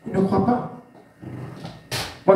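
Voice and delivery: normal talking voice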